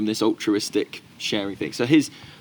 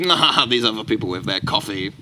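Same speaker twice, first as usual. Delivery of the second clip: mock smug. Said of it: "He probably would have got quite smug about it".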